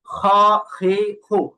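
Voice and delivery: "খ, হে, হু" with breath flowing through back-throat kh; airy kha. Shown as a demonstration. Teacher asks class to check airflow, breathy kha demo. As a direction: let air run on each kh syllable. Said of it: "দেখেন বাতাস চালু হয় কিনা".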